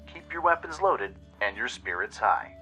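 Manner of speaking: ordinary delivery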